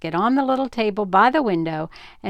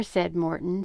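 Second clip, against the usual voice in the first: usual speaking voice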